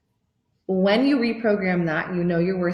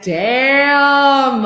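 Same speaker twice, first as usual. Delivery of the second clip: loud, higher mock exclamation; impressed damn; 6 semitones higher, 9 dB louder than usual. Client admiring herself in the mirror, loud exclamation. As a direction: exclaim at your own reflection. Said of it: "I'm like".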